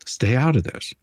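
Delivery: ordinary delivery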